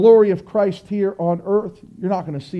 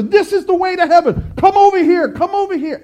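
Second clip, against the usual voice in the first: much higher, calling out to lure; mock enticing yell; 8 semitones higher than usual. Broad-road crowd yelling to believers, high mock calling. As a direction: call them over like a barker.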